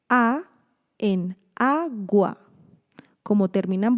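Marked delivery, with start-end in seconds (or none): 1.5-2.4 "agua" slowly split as a, gua; syllable by syllable. Shown as a demonstration. Teacher showing a lone-vowel syllable, "agua" split slowly. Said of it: "puede construir una sílaba".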